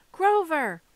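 loud, high cry of the name; urgent, frightened call; alarmed shout. Acted. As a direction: shout to rouse a hurt friend. Percy finds his friend motionless.

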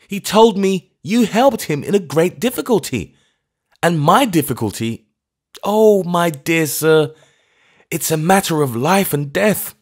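put-on high, loud pleading from a frantic old clergyman; feverish old vicar. A narrator's reading. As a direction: beg him, desperate and fluttering.

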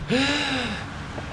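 high drawn-in gasp; shocked gasp. Real, not acted. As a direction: suck in air, horrified. Shocked at forgotten passenger.

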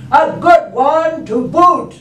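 high, loud, slow chorus shout; pirate crew echo. Acted. Crew echoes their captain, loud pirate chorus. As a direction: echo it like a whole rowdy crew. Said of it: "And the crew said".

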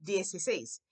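dieciséis slurred into one word; dieciséis blended, one word; one run-together word. Shown as a demonstration. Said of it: "kinda eat it and make it one word".

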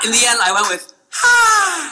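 a sudden high bark of Ha; startled high-pitched Ha; sharp Ha. Retold or imitated. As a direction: blurt one short high Ha. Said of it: "Don't know why I made the sound".

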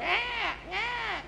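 very high-pitched mock baby wail; imitated baby cry. Preacher shows what strangers hear from a baby, imitated crying. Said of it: "hear the baby going".

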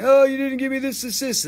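high, loud mock whine; exaggerated complaining voice; mocking complainer. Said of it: "who was complaining".

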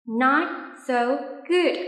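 ordinary talk, nothing marked

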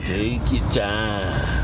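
lazy drawn-out echo; very slow drawl. Acted. Friends echoing at the drive-thru, very slow drawl. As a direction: stretch every word out slowly.

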